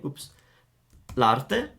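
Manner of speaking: ordinary talk, nothing marked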